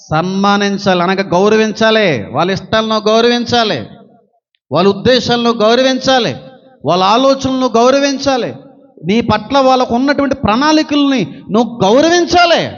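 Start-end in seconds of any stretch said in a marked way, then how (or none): 2.3-12.7 rising fervent preaching. Acted. Preacher building exhortation.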